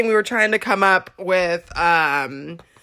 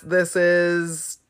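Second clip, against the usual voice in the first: false start; 3 semitones lower, 4 dB quieter than usual. Shown as a demonstration. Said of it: "no, that's not how I say it".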